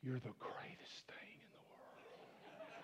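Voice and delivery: breathy whispered woman's voice, slow; mock woman's whisper. Preacher imitating a woman flattering a man, whispering. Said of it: "get a woman to look at us and go".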